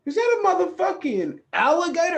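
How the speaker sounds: high, loud alarmed question; shocked disbelief. Pretend. Pretending to find an alligator on the doorstep, high shocked yell. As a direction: yelp it like you just opened the door on a gator.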